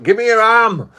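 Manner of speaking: hammed-up cop yelling an order; imitated police yell. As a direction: bark it like the officer on top of you. Officer quoted pinning him down.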